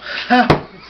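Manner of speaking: breathy burst of laughter at his own line; real laugh. Real, not acted. Teller laughing at his own Winston-Salem punchline, real laugh. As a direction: crack up at the joke.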